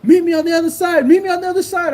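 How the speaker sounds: high, heated retold taunting; trash talk. Seller talking trash in rival's face, high-pitched taunt. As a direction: goad him into a fight, reenacted. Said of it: "I was just talking shit in".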